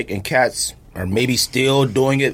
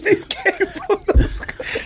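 laughing mock voice; slower than usual. Joking factory worker voice.

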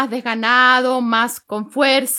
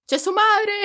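Chilean singsong; 8 semitones higher, slower than usual. Shown as a demonstration. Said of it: "el cantadito es chileno".

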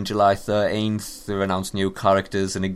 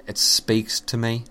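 ordinary talk, nothing marked

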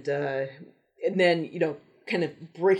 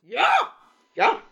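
bad German accent; 9 semitones higher, 4 dB louder, slower than usual. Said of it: "the bad, uh, German accent".

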